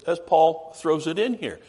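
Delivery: usual speaking voice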